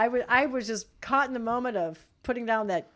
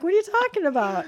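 higher, louder confused what-are-you-talking-about; baffled high question; 9 semitones higher, 7 dB louder than usual. Retold or imitated. Mom baffled by kids giggling at the song, higher and louder. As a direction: play the puzzled mom, pitch up in disbelief. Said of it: "I'm like".

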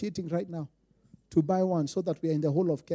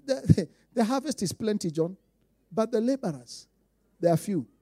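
ordinary talk, nothing marked

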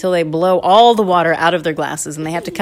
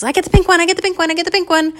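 high, fast, excited chant; excited child; 13 semitones higher, 5 dB louder, much faster than usual. Acted. Candy claims the pink straw, in an excited high chant. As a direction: claim it in a thrilled kid's squeal. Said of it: "so, so excited".

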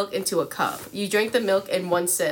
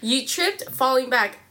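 usual speaking voice

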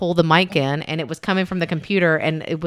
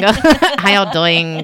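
slow, drawn-out mock Southern greeting; slow bad Southern impression; 5 dB louder, much slower than usual. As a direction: stretch out a bad Southern drawl. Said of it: "I can't do it. It's so bad".

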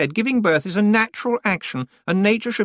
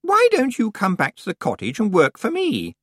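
brittle brightness; 10 semitones higher than usual. Acted. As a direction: sound friendly while about to crack. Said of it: "as bright as a crystal that was about to shatter".

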